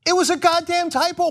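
high mocking put-on voice; mock spokesperson.

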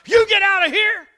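high, full-volume mock holler; retold screaming. Guide screaming at the grizzly to leave, retold holler. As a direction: yell it as the climax of a bear fight. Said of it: "I went to hollering and screaming".

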